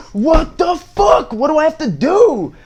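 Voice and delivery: put-on blowing up at a kid who won't fight back; exasperated shout. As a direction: yell it in total disbelief. Exasperated retold outburst.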